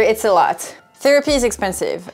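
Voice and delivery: ordinary delivery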